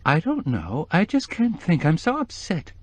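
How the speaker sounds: weeping voice, pitched high and shaky with tears; a widow's grief spilling over the phone; tearful, high. A narrator's reading. Grieving woman on the phone, voice high with tears. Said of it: "She burst into tears".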